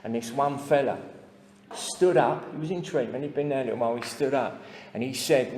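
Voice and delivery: normal talking voice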